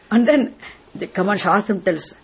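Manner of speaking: ordinary talk, nothing marked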